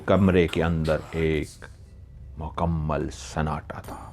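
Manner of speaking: low, slow and soft; a hushed, slow telling of the still room; hushed narration. A narrator's reading. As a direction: let the silence of the room in, hushed. Silent room after waking.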